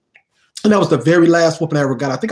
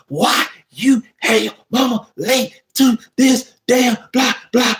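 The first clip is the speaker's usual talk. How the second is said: higher auntie voice, each word hit with the belt; auntie scolding; 7 semitones higher than usual. Retold or imitated. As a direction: scold in time with the belt strokes. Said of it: "Every damn syllable, every damn word".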